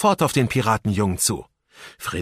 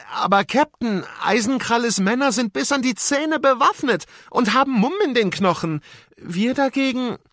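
high put-on pirate voice, hesitant objection; doubtful bearded pirate; 4 dB louder than usual. A narrator's reading. Bearded pirate doubting the plan to his captain, nervous.

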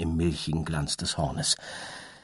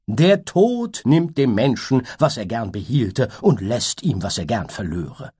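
high reciting butterfly; 4 semitones higher, 5 dB louder than usual. Acted. Butterfly answers the unicorn with lofty high recitation. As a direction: recite it like a grand verse.

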